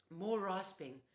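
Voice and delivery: ordinary delivery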